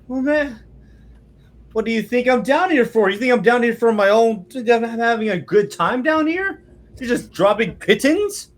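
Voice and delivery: high, loud, exasperated complaint; exasperated trapped man. Acted. Trapped man complains to the adventurers above, high and exasperated. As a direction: whine up at them, fed up. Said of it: "He's like".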